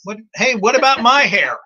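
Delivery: loud, high-pitched mock protest; jokey, jealous outburst; mock indignant. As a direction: playfully demand your share of the compliment. Joking protest at co-host's compliment.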